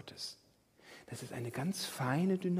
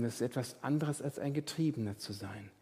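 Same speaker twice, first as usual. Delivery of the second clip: normal talking voice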